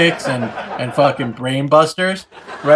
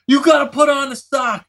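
put-on much higher, insistent kid's shout; bossy demand; 9 semitones higher than usual. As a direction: order her to put it on. Insisting to his sister.

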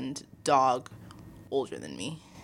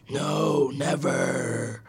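low, breathy demon growl of refusal; the possessed dog says no; demon dog refusing; 3 semitones lower, much slower than usual. Retold or imitated.

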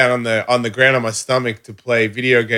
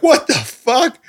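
high-pitched incredulous question; mock baffled protest; imitated bewilderment; 14 semitones higher, slower than usual. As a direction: act out stunned disbelief. Acts out his bewildered reaction.

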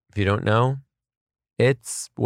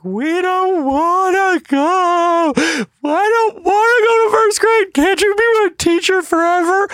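put-on very high, loud kid whine; squealing mock-child wail, begging the teacher; wailing first graders; 7 dB louder than usual. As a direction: drag it out like small kids pleading. Imitating first graders begging to stay.

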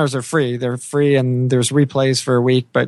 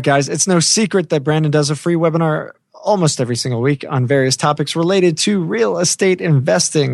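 ordinary delivery

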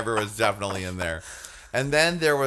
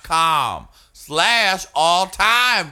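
higher, very slow, every word spaced; slow emphatic repeat; 5 semitones higher, 5 dB louder, much slower than usual. Real, not acted. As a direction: draw it out with emphasis. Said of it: "I said it's".

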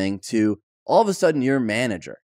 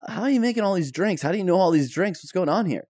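high, quick, puzzled quizzing; baffled questions; 6 semitones higher, faster than usual. Retold or imitated. Friend grills bartender about the drinks, fast high bafflement. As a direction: rattle off the questions, pitched up and amazed.

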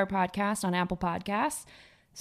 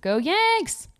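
loud high team cheer; 11 semitones higher, 4 dB louder than usual. Real, not acted. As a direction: shout it for the team.

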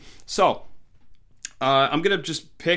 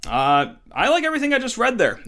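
excited burst; 10 semitones higher, 5 dB louder, faster than usual. Real, not acted. Thrilled reaction to scouting report.

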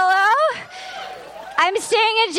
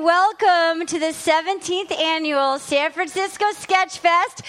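ordinary talk, nothing marked

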